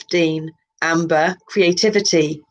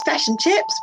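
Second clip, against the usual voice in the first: fish and chips with the Kiwi i, fish close to fesh, pitched higher; Kiwi accent; 11 semitones higher than usual. Shown as a demonstration. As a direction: say fish and chips the New Zealand way. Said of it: "one's Kiwi".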